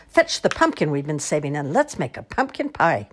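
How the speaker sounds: ordinary delivery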